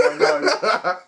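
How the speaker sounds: usual speaking voice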